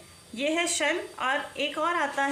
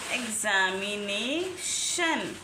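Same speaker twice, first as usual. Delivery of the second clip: "Examination" spelled out slowly and lower, every syllable stretched; slow, drawn-out demo; 5 semitones lower, much slower than usual. Shown as a demonstration. Teacher repeats the word slowly for students to copy. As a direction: drag "examination" out so each syllable is heard.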